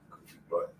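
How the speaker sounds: whispered pet name to the dog. Real, not acted. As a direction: breathe it, barely voiced.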